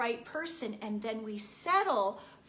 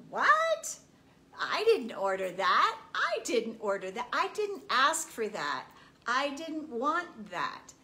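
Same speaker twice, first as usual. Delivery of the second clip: like a diner sent the wrong dish; indignant refusal; 4 dB louder than usual. Acted. Annoyed diner telling the universe she didn't order that.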